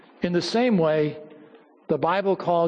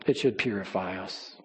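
much softer and lower repeat; soft echo; 9 semitones lower, 7 dB quieter than usual. Real, not acted. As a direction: let the repeat sink into a hush.

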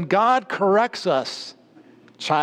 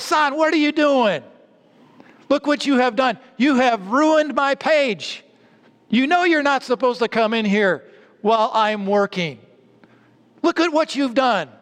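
angry father scolding; 7 semitones higher than usual. Retold or imitated. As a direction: scold the boy for ruining the page. Father scolding boy over ruined ledger.

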